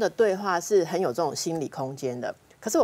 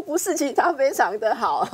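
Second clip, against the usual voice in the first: insisting protest; 8 semitones higher, 5 dB louder than usual. Retold or imitated. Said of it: "你就说".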